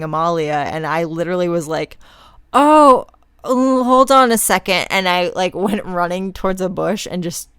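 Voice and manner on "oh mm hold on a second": sudden alarm. Retold or imitated. About to vomit mid-run.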